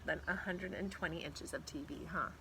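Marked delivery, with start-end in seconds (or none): none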